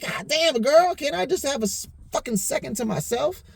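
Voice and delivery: high, fed-up complaint; exasperated. Retold or imitated. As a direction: beg for a moment alone. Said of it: "I'm like".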